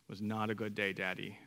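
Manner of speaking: put-on low, soft dejected little-boy confession; four-year-old son. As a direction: lightly imitate a glum preschooler owning up. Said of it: "our four-year-old".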